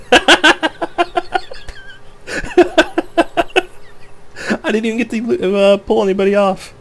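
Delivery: high, laughing through the words. Real, not acted. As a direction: giggle while you speak. Laughing at being left behind.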